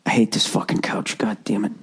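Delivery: whispered low grumble; muttering. Real, not acted. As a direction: grumble to yourself. Grumbling at couch.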